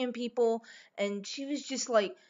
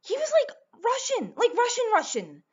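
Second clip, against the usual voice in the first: excited gushing; 12 semitones higher, 5 dB louder than usual. Real, not acted. Gushing about actor's accent.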